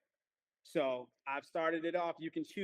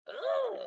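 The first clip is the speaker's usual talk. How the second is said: high loud "ugh" of delight; high, loud ugh of amazement; excited high groan; 7 dB louder than usual. Real, not acted. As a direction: squeal an impressed ugh. Excited reaction to answer.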